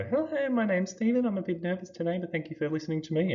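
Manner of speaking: very high squeaky nervous speaker; put-on high voice for a nervous introduction; high pitch. Shown as a demonstration. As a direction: demonstrate a very high-pitched voice, easing down slightly. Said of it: "how high, how low".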